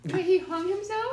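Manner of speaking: high, loud, slowed disbelief; stunned, drag it out in shock. Real, not acted. Shocked at news.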